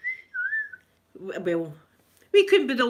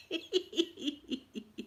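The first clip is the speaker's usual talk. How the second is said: quiet laugh; gentle laugh; soft laughter; 5 semitones higher, 7 dB quieter than usual. Real, not acted. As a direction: laugh softly.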